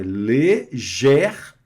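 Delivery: léger split into closed E and open E; léger, closed then open E. Shown as a demonstration. French teacher explains the accents, léger with closed then open E. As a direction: contrast the two E sounds in léger. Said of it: "dando a ideia de um som aberto".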